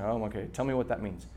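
low, soft, quick mock-grudging aside; mildly frustrated. Preacher voicing listeners puzzled by the message, mildly frustrated. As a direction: voice a listener's frustration under your breath. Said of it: "I know that can be frustrating".

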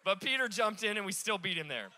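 ordinary delivery

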